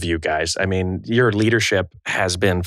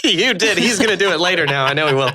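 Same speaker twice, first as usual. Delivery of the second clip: laughing teasing. Real, not acted. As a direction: tease him through laughter. Teasing friend about yodeling.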